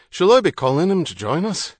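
loud, hearty Irish man calling out; a confident Irishman hailing strangers; bold Irish traveller. Acted. Harrody answers loudly.

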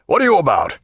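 lightly voiced jovial captain; captain's hail. A narrator's reading. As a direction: call out cheerfully to the doctor. Captain's cheerful question.

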